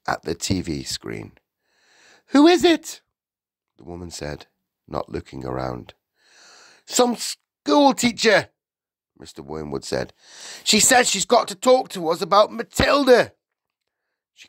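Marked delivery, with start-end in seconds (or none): 2.3-3.0 very high, loud query; high-voiced, distracted housewife; annoyed wife. A narrator's reading. Wife calls from the TV. 6.9-8.5 slow, high, loud announcement; loud, dismissive husband; scornful husband. A narrator's reading. Husband calls to his wife. 10.6-13.3 high, loud announcement; raised-voice, put-upon husband; annoyed husband. A narrator's reading. Husband calls to his wife.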